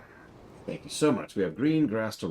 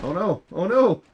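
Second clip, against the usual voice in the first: high, loud alarmed cry; surprised; 12 semitones higher, 9 dB louder than usual. Real, not acted. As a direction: yelp it in sudden alarm. Dad reacting in game.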